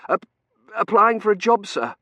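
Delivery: high, nervous false start; stammering. A narrator's reading. T J breaking bad news to Dunworthy, stammering. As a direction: trip on the first syllable, then blurt it. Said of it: "he stammered".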